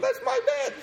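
shoots way up high in outraged disbelief; a squeaky, appalled protest at the mess; indignant squeal. Retold or imitated. Outraged at vomit on his bed.

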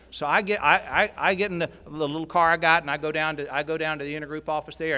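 usual speaking voice

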